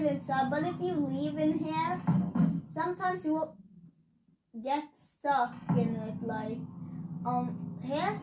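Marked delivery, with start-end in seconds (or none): none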